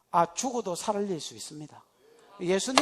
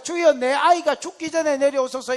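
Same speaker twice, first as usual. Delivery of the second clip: pleading official; 8 semitones higher, 10 dB louder than usual. Acted. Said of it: "신하가 그래서".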